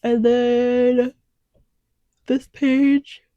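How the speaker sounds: words stretched through a yawn. Real, not acted. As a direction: talk mid-yawn. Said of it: "I don't know why I'm yawning".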